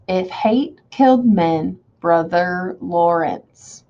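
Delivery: "If hate killed men, Brother Lawrence" slowly in four beats; measured scansion. Shown as a demonstration. Teacher reading to herself to count beats, slow and metrical. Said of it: "I would do it like this".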